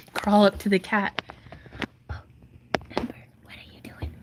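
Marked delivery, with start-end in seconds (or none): 2.1-4.1 fast, hushed whisper right at the cat. Real, not acted. On the floor with her cat, a quick giggly whisper.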